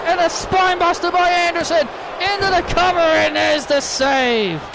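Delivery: high-pitched wrestling call at a climax; excited play-by-play. Acted. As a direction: call the shock hit as it happens. Commentator calls sudden interference and finish.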